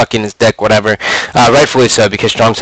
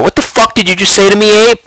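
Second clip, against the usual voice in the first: imitating a sharp angry comeback, higher pitched; retold angry comeback; 8 semitones higher than usual. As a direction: fire it back like you've been insulted. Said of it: "I look over and go".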